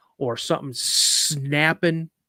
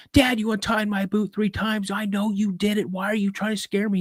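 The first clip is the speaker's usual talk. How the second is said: high-pitched, frantic pleading; retold panic, voice pitched way up; mock screaming kid; 6 semitones higher than usual. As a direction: protest like a scared child at dad. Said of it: "I'm screaming".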